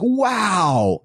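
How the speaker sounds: high, drawn-out retold wow; long wow sliding down from high; excited amazement. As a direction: imitate her delighted astonishment. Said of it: "they were all really excited".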